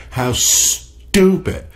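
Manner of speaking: very slow, high exasperated outburst; stretched, incredulous "stupid"; exasperated disbelief. Real, not acted. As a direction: drag out "stupid" in real disbelief. Real exasperation at the article.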